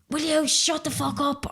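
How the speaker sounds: a high, mock snap from a neighbour's window; retold scolding. Neighbour at the window scolding the midnight funeral, mock annoyed. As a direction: imitate an annoyed neighbour telling them off. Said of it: "giving out to us anyway".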